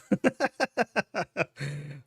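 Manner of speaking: long run of quick laughs; real laugh. Real, not acted. Streamer cracking up at a viewer's donation message, laughing. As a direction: burst out laughing at the joke.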